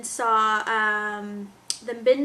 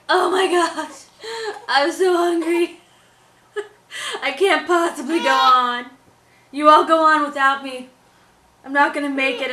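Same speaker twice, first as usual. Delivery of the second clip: loud, hammed-up wailing despair; melodramatic hungry baby; 4 semitones higher, 8 dB louder than usual. Mom imitating her slightly hungry baby, mock despair. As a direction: overact a starving baby's agony. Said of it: "they're just tiny bit hungry. They're like".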